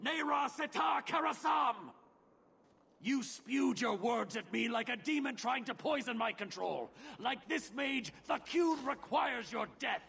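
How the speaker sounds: high, raging cry of condemnation; furious shout. Acted. Arvarad turning on the mage in a furious shout. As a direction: roar it out in pure fury.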